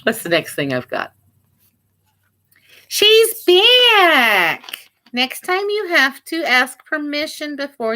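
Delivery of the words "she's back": high, loud delighted cry; excited. Real, not acted. Seller seeing her cat come back, excited high cry.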